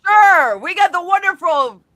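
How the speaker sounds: high, loud hyped-up announcer; radio voice. Acted. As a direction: announce it like a big radio host. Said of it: "her radio voice?".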